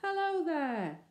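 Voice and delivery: high, slow, loud bellow; boy bellowing across the moat. Acted. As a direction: bellow back at the enemy. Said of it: "Robert bellowed back at once".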